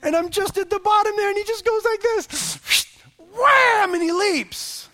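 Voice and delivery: very high, loud build to the jump; excited retelling. Real, not acted. Father describing his son's leap downstairs, excited. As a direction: burst out big on the sound of the leap. Preacher acts out the child's leap.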